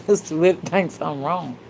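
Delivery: ordinary talk, nothing marked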